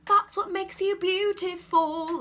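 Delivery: hammed-up English-accented singing; mock thick English accent. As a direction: sing it high and loud in an exaggerated English accent. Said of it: "they aren't like, ... like, thick".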